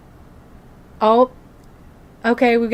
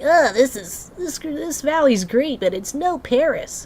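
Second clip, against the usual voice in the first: higher-pitched mock snark, imitating a complainer; snarky imitation; 7 semitones higher than usual. Player imitating Remy's remarks in game, snarky. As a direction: put on a snooty, snarky remark. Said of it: "very snarky remarks like".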